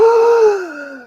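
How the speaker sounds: high, loud sharp intake of breath. Retold or imitated. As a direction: mimic a frail man's alarming gasp. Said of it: "and he's like".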